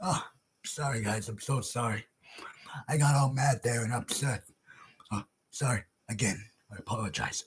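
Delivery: very low, hushed apology; subdued apology. Real, not acted. Ranter saying sorry to viewers after getting mad, subdued. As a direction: apologize softly, deflated after the outburst.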